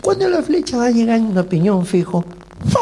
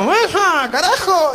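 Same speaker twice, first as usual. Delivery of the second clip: imitating an angry shout, higher pitch; mock angry outburst; 9 semitones higher, slower than usual. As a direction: play-act the hostess snapping at the table. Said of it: "y se enojó".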